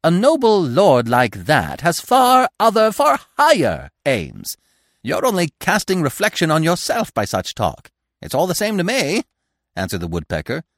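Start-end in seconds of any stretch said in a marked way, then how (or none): 0.0-7.9 scolding squirrel. A narrator's reading. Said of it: "the squirrel went on scolding". 8.2-9.2 high, carefree bird voice; cheerful woodpecker. A narrator's reading. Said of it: "he cried cheerfully".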